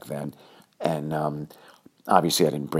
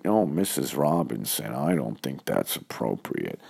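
a hint of a flustered Dustin Hoffman turning down a seduction; Dustin Hoffman impression; 4 semitones lower than usual. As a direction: do a quick, understated Hoffman. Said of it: "that was my Dustin Hoffman".

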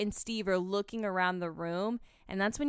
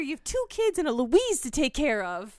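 loud, raised cartoon scolding; Louise; 6 semitones higher, 5 dB louder than usual. Cartoon daughter slapping someone who's losing it, loud scolding. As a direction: imitate a bossy slap-them-out-of-it rant. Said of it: "like how Louise slaps Linda".

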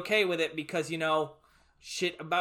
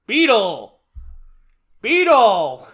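loud, drawn-out shout with falling pitch; auditioner's summoning call; 4 semitones higher, 14 dB louder, much slower than usual. Acted. Count Fangula auditioning with his banana, bellowed call. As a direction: bellow it like a magic command. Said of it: "And he goes".

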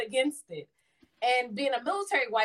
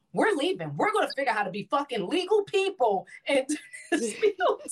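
excited; 4 semitones higher than usual. Retold or imitated. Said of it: "I was like".

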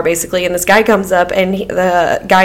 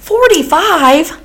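incredulous; 9 semitones higher, much slower than usual. Real, not acted. Incredulous at friend's age.

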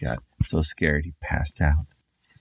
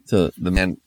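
ordinary delivery

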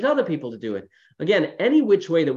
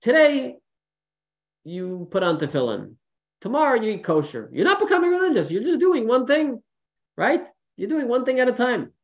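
raised, lively retelling of the rabbi's reply; rabbi's gentle advice; 10 semitones higher than usual. Retold or imitated. Rabbi reassuring a worried man, higher and lively. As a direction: coax him along one step at a time. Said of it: "He says".

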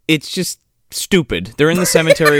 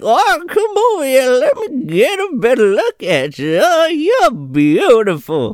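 put-on high, slow cooing old woman; old woman luring the hero closer; creepy old lady; 9 semitones higher than usual. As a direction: coo at him like a creepy granny. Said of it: "She's like".

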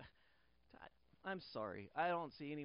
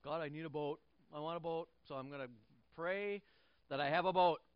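mock greedy prayer; 4 semitones higher, 10 dB louder than usual.

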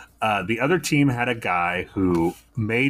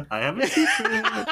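high, laughing through the words. Real, not acted. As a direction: giggle the line out. Laughing at own mustache joke.

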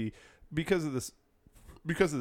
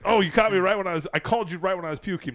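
higher, louder hammed-up sick call; fake puking caller; 6 semitones higher, 5 dB louder than usual. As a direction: act caught in the middle of being sick. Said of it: "fake puking".

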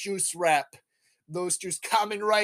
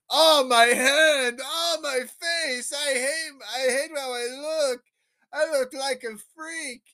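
high, drawn-out mock wailing; mock anguish; 10 semitones higher, 3 dB louder than usual. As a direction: wail it melodramatically, stretching the words. Distraught hero voiced in a retelling.

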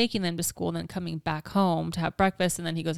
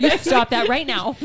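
mock scolding; 5 semitones higher, 7 dB louder than usual.

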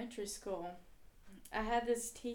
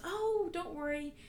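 lightly voiced breezy friend, higher pitch; retold reassurance; 5 semitones higher, 4 dB louder than usual. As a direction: brush it off as a friend would. Voicing friend giving reassuring directions.

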